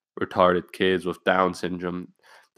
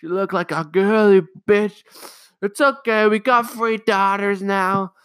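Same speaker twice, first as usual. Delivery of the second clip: mocking mom; 11 semitones higher, 5 dB louder than usual. Mom lamenting to family about her son, hammed-up mock. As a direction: ham up the dramatic mother.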